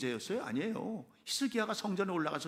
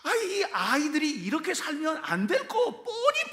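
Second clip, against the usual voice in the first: exasperated cry; 11 semitones higher, 9 dB louder than usual. Acted. Exasperated parent, building.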